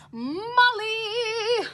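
high sing-out call, held long; excited near-scream. Acted. Mom hails her friend, practically screaming. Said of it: "my mom practically screamed".

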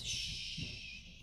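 long soft shush; hushing. Acted. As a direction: hush them with a drawn-out shh. Penguin hushes the rabbit.

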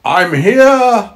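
high granny calling from inside; muffled cry. A narrator's reading. Grandmother from inside the wolf, high muffled cry. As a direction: call out high as if shut in. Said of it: "cried a muffled voice from inside the wolf".

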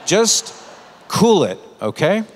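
a voice from above telling him to calm down; firm inner rebuke. Retold or imitated. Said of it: "the Holy Spirit saying to me".

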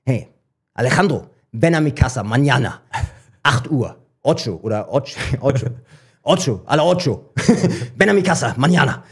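mock broken Spanish, threatening Alejandro. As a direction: stumble through Spanish threats, laughing at yourself. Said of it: "mit meinem gebrochenen Spanisch".

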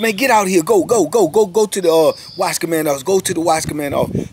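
high, loud barked command; captain's order. Retold or imitated. Captain ordering the sergeant to the office, high loud command. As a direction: send him away, now. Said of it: "he said".

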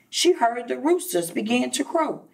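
ordinary delivery, nothing marked

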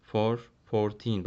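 put-on teacher contrasting the number and its teen form; slow teaching demo. Shown as a demonstration. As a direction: lay out "Three" then "thirteen" slowly for the class. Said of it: "Teen getiriyoruz sadece".

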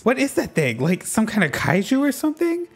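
voicing the boy's wonder at the beast; read-out amazement. Streamer reading the boy's line about Miraidon, amazed.

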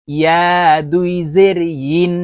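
ইয়া drawn out with a y glide, ইন as yin; clear ya, not hamza. Shown as a demonstration. Teacher correcting learners, ইয়া and ইন with a y, no glottal catch. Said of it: "আমরা ইয়ার উচ্চারণ এভাবে বলবো".

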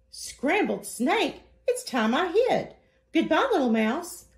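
a snake suddenly scared for its life; mock panic, voice jumping up; startled snake. Snake scared off, hides fast.

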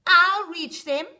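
loud, slow brag; boastful. A narrator's reading. Rocket bragging to the astronaut, loud boast. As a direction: boast it proudly. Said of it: "boasted Little Rocket".

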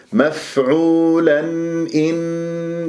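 "مفعولا إن" chanted slowly with the n sounded clearly; drawn-out recited "مفعولا إن", no merging of n; clear noon. Shown as a demonstration. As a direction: sound the tanween n cleanly before the hamza in "مفعولا إن". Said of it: "لازم أظهر".

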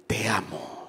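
softly whispered te amo; a breathy, intimate te amo. Shown as a demonstration. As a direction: whisper it like a secret. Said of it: "No, no, no".

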